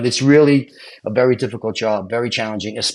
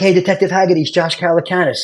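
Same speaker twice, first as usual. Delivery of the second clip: higher-pitched, eager phone greeting; retold urgent call; 7 semitones higher than usual. Applicant ringing his investigator from a payphone, urgent greeting. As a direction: re-enact a keyed-up hello.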